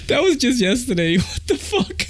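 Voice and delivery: high-pitched, talking through laughter; laughing hard. Real, not acted. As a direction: barely get it out for laughing.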